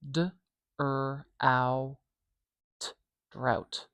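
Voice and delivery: D, er, ow, t spoken apart, then "drought" whole; sound by sound. Shown as a demonstration. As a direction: split "D, er, ow, t" into separate sounds, then join them as "drought". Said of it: "How many sounds do you hear".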